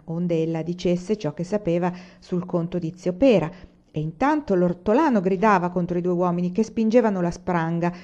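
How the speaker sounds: usual speaking voice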